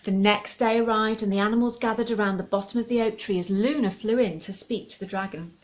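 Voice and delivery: usual speaking voice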